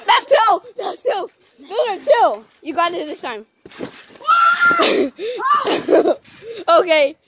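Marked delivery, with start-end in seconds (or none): 4.2-6.2 high burst of laughter. Real, not acted. Laughing at flip.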